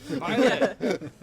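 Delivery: laughing shout of a spell name. Acted. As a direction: bellow the word with a laugh.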